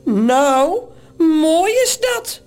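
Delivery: drawn-out indignant complaint; slow, rising sarcastic grumble; calling out. A narrator's reading. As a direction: exclaim it with mild exasperation. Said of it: "roept Morrel".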